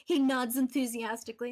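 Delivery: ordinary talk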